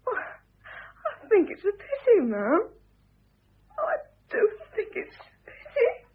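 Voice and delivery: a maid nearly crying over her mistress; choked-up sympathy, close to sobbing; tearful. Acted. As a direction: voice breaking into tears, slow. Maid weeping over mistress's marriage.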